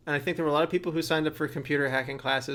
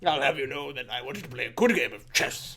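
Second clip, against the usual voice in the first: mutton chop British guy. Retold or imitated. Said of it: "that's my mutton chop British guy".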